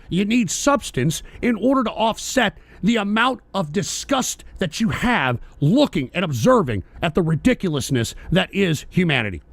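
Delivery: usual speaking voice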